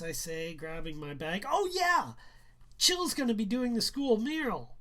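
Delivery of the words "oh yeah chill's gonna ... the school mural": high, loud sudden remembering; boy eagerly telling the mom; excited announcement. Acted. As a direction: blurt out the news eagerly.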